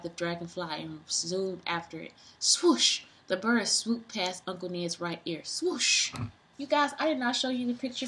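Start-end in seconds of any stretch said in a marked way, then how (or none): 2.4-3.0 breathy high swoosh; whooshing swoosh. Acted. Reader voicing bird swooping by, airy swoosh. 5.4-6.1 breathy high swoosh; whooshing swoosh. Acted. Reader voicing bird swooping by, airy swoosh.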